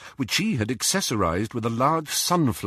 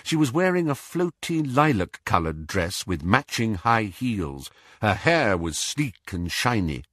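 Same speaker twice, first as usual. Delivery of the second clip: usual speaking voice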